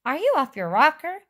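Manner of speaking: high, loud disbelief; incredulous cry. A narrator's reading. Wonka questions the boy's sanity, high incredulous cry. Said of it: "cried Mister Wonka".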